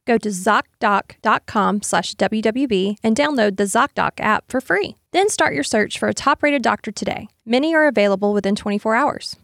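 upbeat sponsor read. Acted. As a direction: sell it to the listeners. Reads a sponsor ad.

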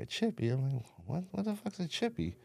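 what on earth is that, high and baffled; baffled disbelief. Retold or imitated. Hearing his friend call him dope sick, in high disbelief.

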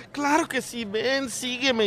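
campy guy. Acted. Other roommate answers campily.

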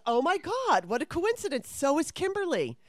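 high, hammed-up fake surprise; exaggerated gasp of coincidence; mock-surprised friend. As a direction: play the matchmaking friend, overacting. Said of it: "she goes".

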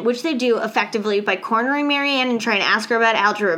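normal talking voice

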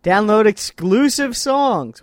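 loud, slow hammed-up ad read; announcer voice. Reads box blurb in announcer voice.

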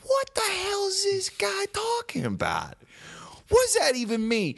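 mock outraged confusion; incredulous. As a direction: ask it like you can't believe your ears. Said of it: "I'm like".

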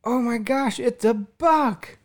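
put-on high, slow, amazed child squeal; child freaking out. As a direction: stretch it out like a toddler who's amazed. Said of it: "she's freaking out that it's a buck".

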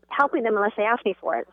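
ordinary talk

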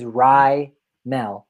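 slow, two-part rye-MELL for Raimel; guide pronunciation. Shown as a demonstration. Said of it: "in the pronunciation guide".

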